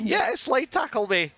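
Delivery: high, slow mock of Yangi; mock eager Yangi. Imagining Yangi asking for a tackle, high and eager. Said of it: "Yangi would probably be like".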